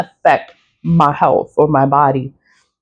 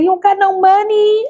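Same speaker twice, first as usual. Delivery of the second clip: much higher mock old lady voice, incredulous; high-pitched teasing old lady; imitating old lady. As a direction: squeak it up like the old cashier. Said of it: "she's an old lady".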